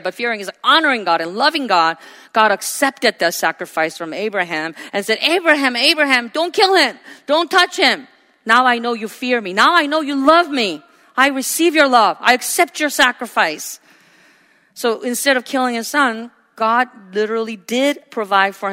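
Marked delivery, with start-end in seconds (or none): 5.2-13.8 urgent call. Acted. God calls out to stop Abraham.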